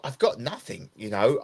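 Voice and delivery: ordinary talk, nothing marked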